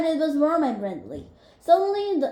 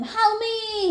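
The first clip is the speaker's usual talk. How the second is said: screaming; 7 semitones higher than usual. A narrator's reading. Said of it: "he screamed".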